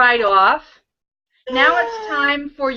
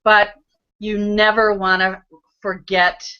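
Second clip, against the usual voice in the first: ordinary delivery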